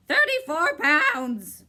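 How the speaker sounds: high, loud, slow shriek. A narrator's reading. Bird shrieking as he disappears into fog. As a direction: shriek it as you vanish. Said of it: "shrieked the bird".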